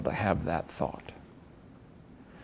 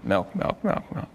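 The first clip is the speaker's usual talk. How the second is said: rapid repetition; 11 semitones higher, slower than usual. Shown as a demonstration. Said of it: "I'll say it with you rapidly".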